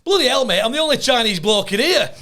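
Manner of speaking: loud, high-pitched broad Yorkshire; broad Yorkshire accent. Retold or imitated. Client answering the phone to the comic, broad Yorkshire accent. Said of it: "he's got this really broad Yorkshire accent".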